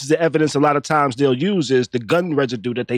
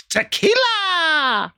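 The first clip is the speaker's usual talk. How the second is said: high, slow, drawn-out witchy wail; witch voice; 13 semitones higher, much slower than usual. Real, not acted. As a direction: croon it like a witch. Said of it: "I said that weird".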